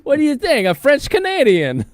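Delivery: squeaky put-on whine; whiny ex impression. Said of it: "this is my ex voice".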